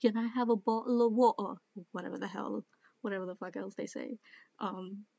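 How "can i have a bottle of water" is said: louder, quick mock-British accent. Shown as a demonstration. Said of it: "stereotypical British accent that we think of".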